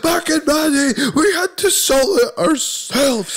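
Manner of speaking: high, slow back-in-my-day moaning; squeaky old codger complaining; grumpy old-timer. Acted. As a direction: lecture the youth like a cranky grandad. Grumpy old-timer rant about crisps.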